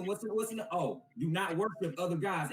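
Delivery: ordinary talk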